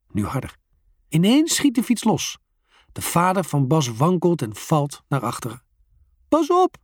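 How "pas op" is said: high, loud cry of warning; warning shout. A narrator's reading. Bas shouts a warning as his father falls. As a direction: call out to save his dad. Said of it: "roept Bas".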